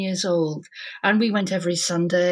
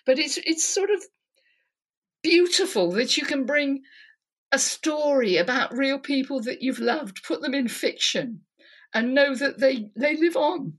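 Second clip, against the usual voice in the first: choked-up and high, with halting pauses; emotional; 4 semitones higher than usual. Real, not acted. Author on keeping loved ones alive in fiction, emotional. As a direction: hold back real tears as you speak. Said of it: "I'm emotional about".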